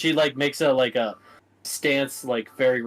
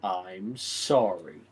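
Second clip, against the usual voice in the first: sincere apology; 7 semitones lower, 6 dB quieter, much slower than usual. Acted. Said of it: "No backhanded compliments in this apology".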